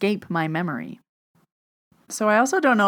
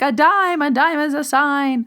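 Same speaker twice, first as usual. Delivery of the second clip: excited; 9 semitones higher, 4 dB louder than usual. Retold or imitated. Excitedly telling aunt about dime.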